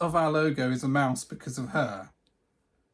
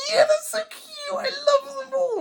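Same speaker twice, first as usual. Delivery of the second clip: laughing shriek, very high; high squealing laugh-talk; 5 dB louder than usual. Retold or imitated. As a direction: squeal through laughter imitating her. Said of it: "And she was like".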